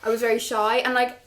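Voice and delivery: usual speaking voice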